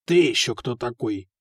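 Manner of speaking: low, mildly displeased challenge. A narrator's reading. Foka challenges a skinny stranger at the tavern, mildly displeased. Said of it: "недовольно спросил Фока".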